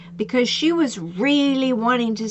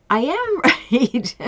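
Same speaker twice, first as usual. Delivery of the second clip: laughing agreement; 2 semitones higher, slower than usual. Real, not acted. Jokes after client concedes.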